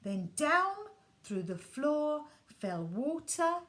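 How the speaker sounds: ordinary delivery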